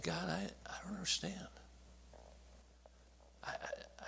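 very soft, faltering plea; hurt, bewildered prayer. Retold or imitated. Young man praying in his car after weeping, quietly bewildered. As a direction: falter into near-silence, bewildered. Wept in car, questioning God.

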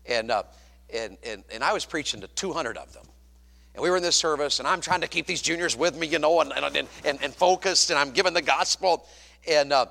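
ordinary talk

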